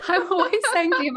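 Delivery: laughing through the words; giggling as she talks. Real, not acted. Laughing while telling husband story.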